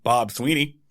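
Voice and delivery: ordinary talk, nothing marked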